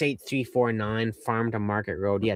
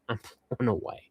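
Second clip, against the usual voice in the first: whispered with a little laugh, softer; 6 dB quieter, faster than usual. Real, not acted. To fan George on the stream, a laughing whisper. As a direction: breathe it out, amazed and giggling.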